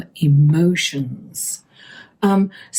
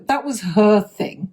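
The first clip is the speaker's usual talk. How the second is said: ordinary delivery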